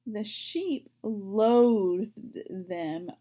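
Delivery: the sheep "loathed" them, drawn out slowly, every word weighted; slow stress on loathed. Shown as a demonstration. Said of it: "that means that they really don't like them".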